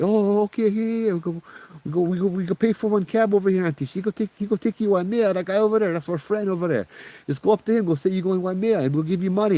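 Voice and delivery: high, babbling, repetitive urging; hammed-up panic under eager politeness; frantic placating. Scared boys palming auntie off on taxi driver, high and frantic.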